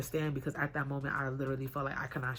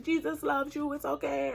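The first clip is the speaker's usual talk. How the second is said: much higher, sing-song kind lady; comforting stranger; 12 semitones higher, 4 dB louder than usual. Retold or imitated. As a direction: soothe a crying stranger. Passing lady comforting crying stranger.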